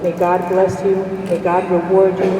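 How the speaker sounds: normal talking voice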